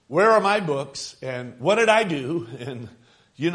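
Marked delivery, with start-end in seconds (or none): 0.1-1.2 put-on loud, higher call of a child looking for books. Kid calls to the house, a loud, higher-pitched call. 1.6-2.5 loud, higher whining call; child calling out. Retold or imitated. Kid asking the house, a loud, higher-pitched call.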